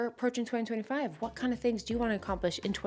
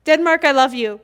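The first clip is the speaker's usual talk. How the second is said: Denmark, I love you shouted out joyfully; loud shout; 6 semitones higher, 12 dB louder than usual. Real, not acted. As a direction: belt it out with joy.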